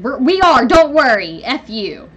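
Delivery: loud, high shouted defiance; angry yell. Retold or imitated. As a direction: shout it at him, then spit the last words. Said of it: "Or I said".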